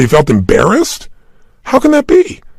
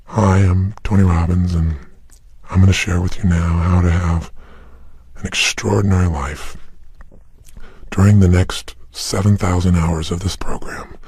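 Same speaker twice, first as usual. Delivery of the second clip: boring, lifeless delivery; 9 semitones lower, 5 dB quieter than usual. Pretend. Mocking how a dull self-help tape would open, lifeless drone. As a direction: drone like a dull tape intro nobody wants. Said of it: "what if you got this tape set and I said".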